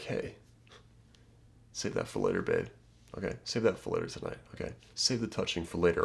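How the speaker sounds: teasing low hush; low flirty murmur. Pretend. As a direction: murmur it close, like a secret promise.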